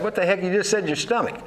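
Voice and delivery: high, exasperated retort; retold disbelief. Father to son who said stomach, high exasperation. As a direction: push it up high, can't believe it. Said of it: "I said".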